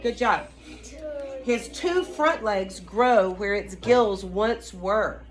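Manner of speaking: usual speaking voice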